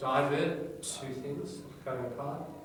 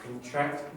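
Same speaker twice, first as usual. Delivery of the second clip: light first syllable, stressed second in Contract; 3 semitones higher than usual. Shown as a demonstration. Teacher modelling the verb for class, stress on the end of Contract. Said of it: "your verb will have the second syllable stress".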